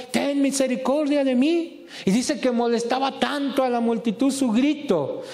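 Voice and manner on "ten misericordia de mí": crying out. Retold or imitated. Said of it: "gritando detrás".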